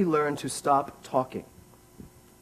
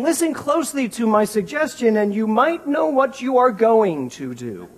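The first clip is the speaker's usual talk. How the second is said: bossy parent; 6 semitones higher, 5 dB louder than usual. Pretend. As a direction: give the order, dressed up as advice. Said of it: "I am saying".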